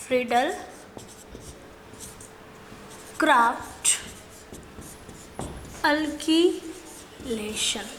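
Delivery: drawn out word by word, long gaps; slow syllable by syllable. Shown as a demonstration. Teacher slowly sounding out the reaction name for students. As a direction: pronounce each part slowly for the class. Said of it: "teacher spells out name slowly".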